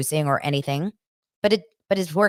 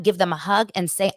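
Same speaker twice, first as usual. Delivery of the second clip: ordinary talk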